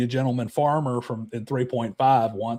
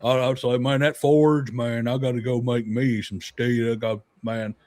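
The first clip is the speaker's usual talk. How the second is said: put-on lazy Southern accent, a bit lower; 4 semitones lower than usual. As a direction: Florida dwarf at the forge, Southern twang. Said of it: "they're all like [name]".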